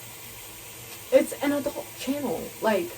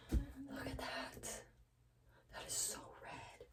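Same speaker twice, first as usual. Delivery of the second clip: whisper; 2 semitones higher, 20 dB quieter than usual. Real, not acted. Showing the dye up close.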